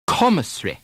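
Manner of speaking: Commissary as CO-mi-ssry, -sary vowel dropped; British pronunciation. Shown as a demonstration. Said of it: "an earlier syllable now gets the stress".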